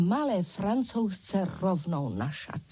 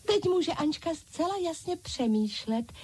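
high-pitched echoing dolls; dolls crying out; 8 semitones higher than usual. A narrator's reading. Other dolls announce that their leader can think, high-pitched exclaiming. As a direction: proclaim it in a high doll voice. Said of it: "zvolali ostatní panenky".

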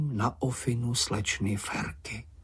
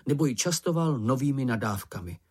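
ordinary delivery, nothing marked

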